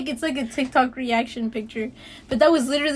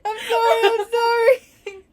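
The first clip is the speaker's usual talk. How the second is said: high, loud laugh-cry apology; sorry through laughter; 10 semitones higher, 5 dB louder, slower than usual. Real, not acted. Apologizing to her teasing friend, laughing high.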